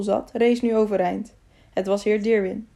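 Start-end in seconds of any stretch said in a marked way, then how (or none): none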